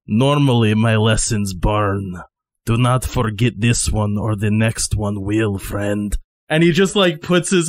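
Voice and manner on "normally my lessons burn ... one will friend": menacing Nostroy. Acted. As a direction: lean in close with a low-pitched threat. Nostroy warns Miguel up close.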